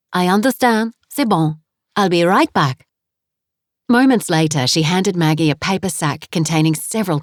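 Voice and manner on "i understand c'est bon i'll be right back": put-on French accent. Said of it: "her accent was heavy".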